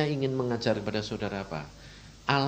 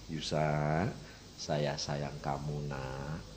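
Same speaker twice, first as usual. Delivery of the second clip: soft, slow and low, full of love; a hushed, tender whisper of love; 9 semitones lower, 6 dB quieter, much slower than usual. Retold or imitated. Preacher voicing Jesus's tender words to him.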